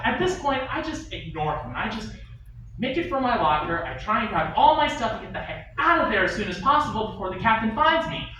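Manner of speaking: normal talking voice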